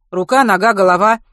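busy medic demanding an answer; brisk questioning. A narrator's reading. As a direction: fire off the options loudly. Busy doctor rattles off options.

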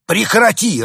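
high, loud angry order. A narrator's reading. Winter spirit scolds his brother, angry. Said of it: "рассердился дух зимы".

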